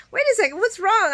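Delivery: high, loud startled question; alarmed. Retold or imitated. Ava alarmed when Nina shows up frantic: high loud surprise. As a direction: snap to attention in alarm.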